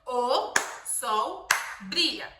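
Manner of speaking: "O sol brilha" very slow, one beat per word; very slow clapped phrase. Shown as a demonstration. Mother clapping each word for her child, very slow. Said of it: "Pra cada palavra, uma palma".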